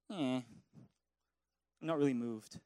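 low, soft, bored mumble; mock boredom. Preacher imitating a bored worshipper in church, low indifferent mumble. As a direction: shrug it off like an unmoved churchgoer.

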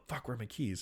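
soft, offhand grumble to oneself; casual mutter. Shown as a demonstration. Showing how to act it, quiet mutter. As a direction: mutter it low-key, almost under your breath. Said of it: "just pat your pockets, look around, and go".

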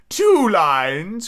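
high, excited turtle call; crying out. A narrator's reading. Mock turtle interrupting the griffin, crying out. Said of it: "cried the mock turtle".

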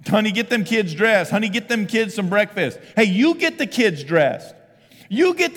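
loud, impatient orders; mock dad ordering his wife around on Sunday morning; bossy dad. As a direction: bark orders at your wife. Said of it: "you're like".

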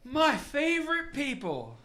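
put-on high, loud drunken greeting; drunk newcomer. Drunk man greeting the bar, loud drunken voice. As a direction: hail the room like a happy drunk. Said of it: "drunk off his ass".

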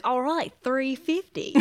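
slow, put-on Australian accent. Trying out an accent on a shop cashier, slow fake Australian. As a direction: stretch it out in a fake Aussie accent. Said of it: "Australian accent just to".

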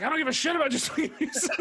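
high, fast, irritated tough guy; Joe Pesci. Acted. Vicar brushing off the young gang, Joe Pesci impression. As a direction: fire it off as a hammed-up crank. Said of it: "now I've cast Joe Pesci in this role".

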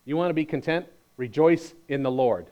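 "Rejoice" landed firmly as an order; Paul giving an order, not a request; firm command, "Rejoice" stressed. Acted. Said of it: "Paul's not asking".

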